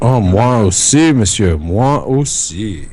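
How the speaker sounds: put-on slow, hammy French accent; mock French accent. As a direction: drawl it like a Frenchman.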